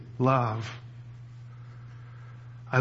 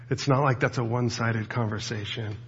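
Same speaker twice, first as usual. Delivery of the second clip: ordinary delivery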